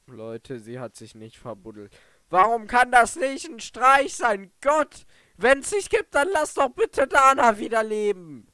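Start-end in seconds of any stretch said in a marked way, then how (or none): 2.3-8.5 desperate cry. Real, not acted. Player grieves dead character.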